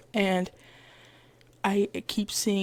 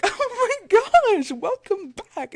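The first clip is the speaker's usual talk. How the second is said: high, thrilled welcome; delighted greeting; 12 semitones higher, 7 dB louder than usual. Retold or imitated. Cashier greeting a returning customer, delighted. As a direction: squeal it as a happy reunion.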